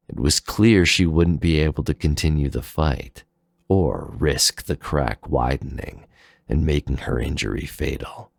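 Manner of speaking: plain reading voice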